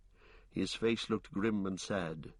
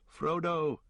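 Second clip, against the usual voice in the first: calling out; 7 semitones higher than usual. A narrator's reading. Sam climbing the hill calling for Frodo, higher call. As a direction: call out for him while toiling uphill. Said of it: "panting and calling".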